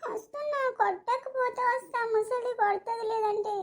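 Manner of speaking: put-on high, small frightened child's voice; a little boy afraid he'll be hit; scared kid, first near a whisper; timid child.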